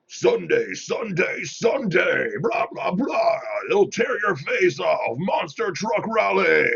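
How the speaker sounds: hyped radio announcer yelling; monster truck ad. Retold or imitated. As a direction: shout it like a monster truck rally ad. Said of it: "you can tell that guy's practically yelling".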